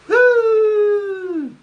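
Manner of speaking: a high, shouted whoop; sudden loud woo of approval; loud cheer. Real, not acted. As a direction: let out a big crowd whoop. Cheering in the crowd.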